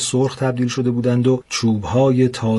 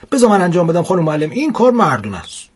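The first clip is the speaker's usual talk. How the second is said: put-on quick, higher-pitched boy's boast; cocky schoolboy; 6 semitones higher, 4 dB louder, faster than usual. Boy telling his teacher to let him take over, cocky. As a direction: push in eagerly, pitched up and louder.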